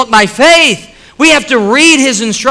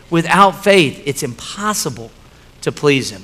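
hushed; 7 semitones lower, 7 dB quieter than usual. Real, not acted. Preacher drops into intimate tone.

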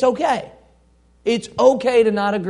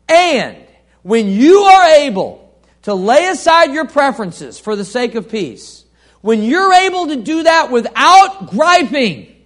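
loud, higher, building exhortation; impassioned preaching; 6 semitones higher, 10 dB louder than usual. Real, not acted. Preacher urging the congregation to lay aside preferences, loud. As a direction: drive the point home with full force.

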